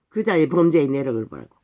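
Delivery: ordinary talk, nothing marked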